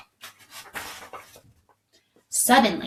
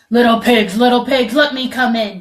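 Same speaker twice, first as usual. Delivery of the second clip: loud, higher wolf demand; 4 semitones higher, 9 dB louder than usual. A narrator's reading. Angry wolf demanding at the brick house. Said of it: "demanded the wolf".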